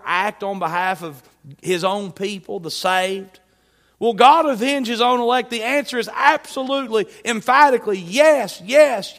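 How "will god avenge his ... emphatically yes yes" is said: high, loud pulpit declaration; emphatic preaching. Acted. Preacher answering whether God will avenge his elect, emphatic preaching. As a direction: drive the answer home with mounting force.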